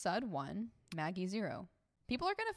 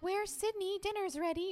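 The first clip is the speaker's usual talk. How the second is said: high calling-out parent voice; 11 semitones higher than usual. Retold or imitated. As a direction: call up the stairs like a parent.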